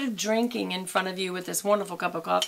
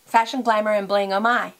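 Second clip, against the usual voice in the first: slogan; 6 dB louder than usual. Acted. Her catchphrase slogan.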